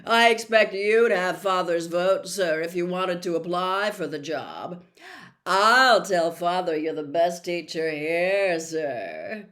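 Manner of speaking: loud, oily schoolboy flattery; Malfoy sucking up to Snape; fawning Malfoy. Acted. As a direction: flatter the teacher, loud and smug.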